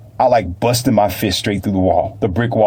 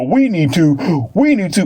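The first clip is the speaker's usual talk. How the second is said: slow, high mocking whine; hammed-up nagging imitation; 8 semitones higher than usual. Mocking repeated talk.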